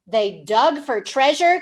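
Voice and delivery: plain reading voice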